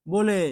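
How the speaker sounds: বলে said "bo-le", closed o; o-sound pronunciation. Shown as a demonstration. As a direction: say বলে with an o-kar, "bo-le". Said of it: "ওকার দিয়ে করতে হবে". (বলে-কে ওকার দিয়ে "বোলে" উচ্চারণ)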